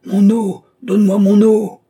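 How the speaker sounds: very loud, high shout; furious scream. A narrator's reading. Dying old man demands his water, furious screaming. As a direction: scream for water with all your force. Last eruption of old man's rage.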